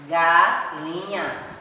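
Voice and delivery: "Galinha" drawn out slowly, syllable by syllable: ga-li-nha; syllables of "Galinha" stretched out for children. Shown as a demonstration. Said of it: "Pronuncia devagar, pausadamente".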